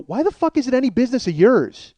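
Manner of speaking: high-pitched heated challenge; indignant comeback. Retold or imitated. Man tells nosy passenger off, indignant. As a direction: demand it, pitch jumping up. Retells his own angry comeback.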